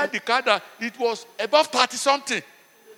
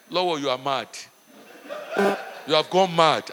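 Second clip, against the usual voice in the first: low, deadpan telling-off; low scolding; 8 semitones lower than usual. Retold or imitated. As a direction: calmly tell your friend he's lost it, low and flat. Retelling scolding a rich friend.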